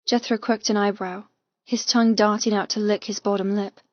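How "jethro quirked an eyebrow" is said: ordinary delivery, nothing marked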